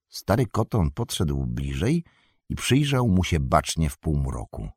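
ordinary delivery, nothing marked

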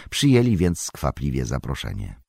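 usual speaking voice

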